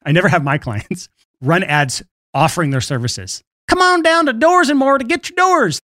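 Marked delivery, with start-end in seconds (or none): 3.7-5.8 high, hammed-up local TV ad pitch; mock radio spot announcer; cheesy ad announcer. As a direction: sell doors like a cheap car-lot commercial.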